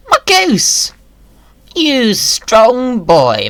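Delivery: squealing high with delight; excited squeal. Real, not acted. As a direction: gush over the goose in a squeaky voice. Delighted at the goose moving.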